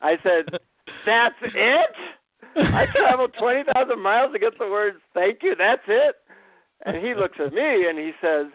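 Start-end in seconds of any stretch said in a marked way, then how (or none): 1.0-6.2 high, loud incredulous "That's it?"; indignant disbelief, pitched way up. Retold or imitated. Traveler protesting the guru's two-word mantra, incredulous and high.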